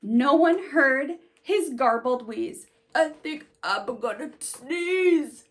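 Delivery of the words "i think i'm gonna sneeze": about to sneeze. Acted.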